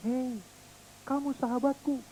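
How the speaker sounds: soft, distant return; friendly echo. Pretend. Echo answering the boy's friendship, soft and far. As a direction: let it drift back softly.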